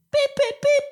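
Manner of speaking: three very high, short beeps; imitated alarm chirp. Imitating the mystery chirping at home, very high beeps. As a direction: squeak out three identical electronic beeps. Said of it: "something's just chirping".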